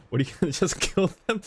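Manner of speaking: high, amused shocked question; laughing disbelief. Real, not acted. Streamer reacting to the character's threat, laughing disbelief.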